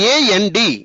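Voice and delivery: AND run together as one unit; joined letters. Shown as a demonstration. Teacher modelling for pupils how to read, AND joined without breaks. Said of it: "ఆ విధంగా పలకాలి".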